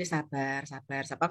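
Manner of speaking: low, soft murmur to oneself; quietly calm yourself down, low and soft; self-soothing. Retold or imitated. Agent telling herself to be patient, self-soothing. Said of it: "sama diri saya sendiri".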